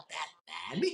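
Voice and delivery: low, soft villain purr; Mark Hamill's Joker. Shown as a demonstration. Villain addressing Batman, soft raspy Joker voice. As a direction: sink low on the name, about to break into laughter. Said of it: "Mark Hamill's Joker".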